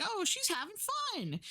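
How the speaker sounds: jumps way up high, light and cheery; bubbly high voice. Retold or imitated. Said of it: "but just in a, like".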